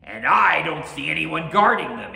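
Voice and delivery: loud, high swooping ogre taunt. A narrator's reading. Oni mocking the samurai guards, loud taunting. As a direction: throw it out as a booming challenge.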